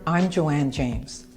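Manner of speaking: ordinary talk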